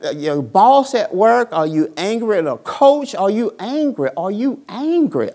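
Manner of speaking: ordinary talk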